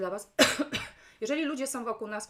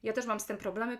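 ordinary talk, nothing marked

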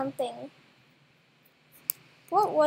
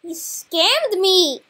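loud, drawn-out cry of disbelief; slow, loud wail at being cheated; outraged cry; 3 semitones higher, 11 dB louder, much slower than usual. Real, not acted. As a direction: stretch it out loudly, really outraged. Just discovered the scam.

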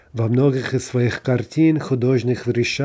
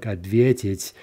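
ответить stressed back on "ве"; stem stress; slower than usual. Shown as a demonstration. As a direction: stretch the stressed "ве" in ответить, final syllable unstressed. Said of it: "back on the stem".

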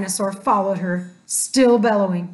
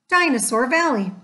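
ordinary delivery, nothing marked

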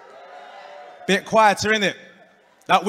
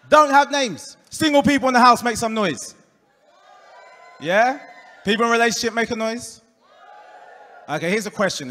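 ordinary talk, nothing marked

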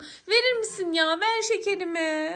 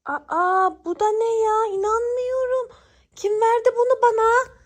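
acted shock; 5 dB louder than usual. Girl finds a tomato instead of her candy, acted shock. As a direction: play up the shocked discovery.

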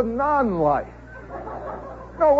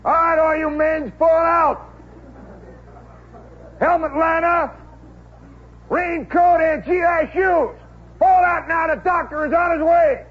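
Kowalski bellowing orders; 6 semitones higher than usual. Retold or imitated. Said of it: "Kowalski ... is blowing a whistle".

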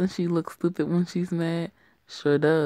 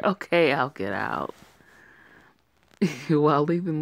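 ordinary talk

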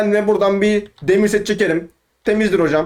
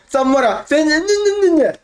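high, outraged squeal of who are you; 8 semitones higher than usual. Real, not acted. Gamer yelling at a rival player, outraged. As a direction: demand it in disbelief, pitch shooting up. Confronting enemy player in game.

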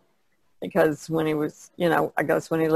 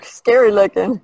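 laughing; 4 semitones higher, 8 dB louder than usual. Real, not acted. Laughing reply about fight painting.

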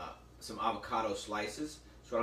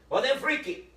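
put-on Latin accent; 5 semitones higher, 7 dB louder than usual. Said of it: "he's got this great Latin accent".

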